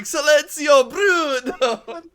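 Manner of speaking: high, loud hammed-up Italian yell; mock Italian shout. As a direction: bellow it like a cartoon Italian. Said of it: "us just going".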